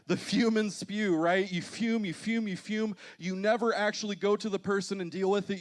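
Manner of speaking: high, loud, driving sermon delivery; emphatic preaching. Real, not acted. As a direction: preach it with force, hammering each phrase. Preacher drives the point home.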